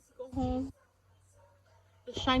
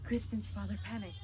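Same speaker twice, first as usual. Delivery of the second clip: ordinary delivery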